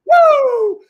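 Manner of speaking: loud high "woo"; piercing victory whoop; excited whoop. Real, not acted. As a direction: let out a big celebratory holler. Celebrating live trading gains.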